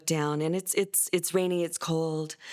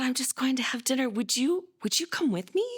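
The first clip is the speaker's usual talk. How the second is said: much higher, eager voice inviting him to dinner; mother coaxing her son to dinner, sweetly; hopeful invitation; 9 semitones higher than usual. Retold or imitated. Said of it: "And I said".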